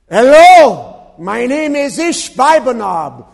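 loud, high bellow in a mock Philistine accent; Philistine giant. Young giant confronts his father's killer, hammed-up bellow. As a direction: announce yourself as the avenging giant, hammed up. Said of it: "This is a Philistia accent here".

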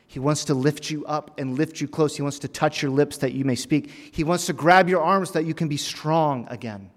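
emphatic pulpit encouragement, lifting to a peak; impassioned preaching. Acted. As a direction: drive each promise home with mounting force. Preaching builds in force.

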